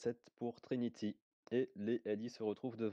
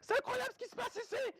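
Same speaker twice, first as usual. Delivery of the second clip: excited. Acted. Hyped caster moment, both shouting.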